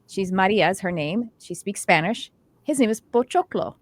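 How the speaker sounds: normal talking voice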